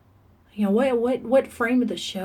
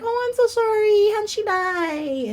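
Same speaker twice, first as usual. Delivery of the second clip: high, gushing pity, slowing on the question; mock sympathy; 14 semitones higher, 6 dB louder, slower than usual. Strangers pitying her mom's death then prying, mock high and slow. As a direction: ham up the nosy sympathizer. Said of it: "they're like".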